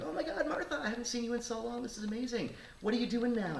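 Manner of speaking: very high, gushing delight; excited greeting. Retold or imitated. Old classmate greeting Martha at a birthday, excited and high-pitched. Acted reunion with old college friend.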